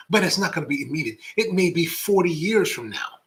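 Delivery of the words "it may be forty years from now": normal talking voice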